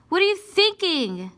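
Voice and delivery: high, loud exasperated scold; shrieking at your own mistake; scolding myself. Acted. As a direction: yell at yourself for a dumb slip. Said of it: "That's how I feel like inside when I mess up".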